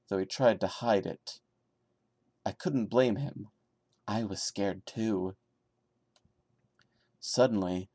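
steady, subdued creepy-story reading; story narration. A narrator's reading. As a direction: read it straight like a campfire tale. Reads creepypasta story aloud.

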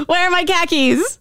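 high, exaggerated Boston question; mock Boston accent. Shown as a demonstration. Host hunting for car keys as demo, mock Boston. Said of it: "Sounds like I lost my car keys".

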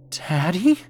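high, rising whimpered question; pleading, disbelieving upward cry; whimpering. A narrator's reading. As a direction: whimper it like a frightened daughter. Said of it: "she whimpered".

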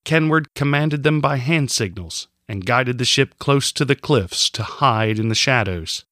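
plain reading voice

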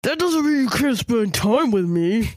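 words forced through clenched teeth; through gritted teeth. Shown as a demonstration. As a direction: jaw locked tight, grinding it out. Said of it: "That was difficult to do".

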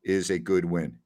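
ordinary delivery

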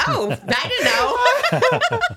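high, slow and laughing through it; mock surprise. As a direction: stretch it out in pretend surprise, giggling. Acting surprised, laughing at herself.

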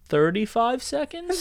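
high, slow, drawn-out incredulous question; mock disbelief. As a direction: stretch it out as if you can't believe it. Boasting his commute is seconds long.

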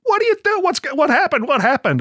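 high, bewildered voices asking; puzzled crew chattering high; confused crew. Retold or imitated. Crew asking what happened, high confused voices.

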